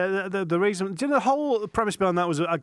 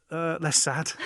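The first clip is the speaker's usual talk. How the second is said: ordinary talk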